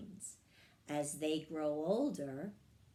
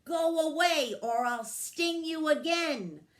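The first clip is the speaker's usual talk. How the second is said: high, loud warning cry; fairy shouting the squirrel off; fierce threat; 9 semitones higher, 7 dB louder than usual. A narrator's reading. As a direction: threaten him with full force. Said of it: "Florrie cried".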